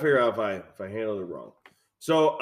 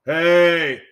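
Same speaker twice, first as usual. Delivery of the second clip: loud, high, drawn-out hey; long hollered hey; mimicked alley yell; 4 semitones higher, 11 dB louder than usual. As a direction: holler it like a stranger calling out. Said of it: "I hear this tone of".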